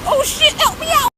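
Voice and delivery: high-pitched mock cry for help; dog's panicked cry. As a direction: yelp it as a panicking dog. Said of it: "Maggie was like".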